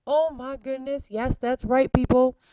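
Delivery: excited exclamation. Real, not acted. Teacher exclaims to class.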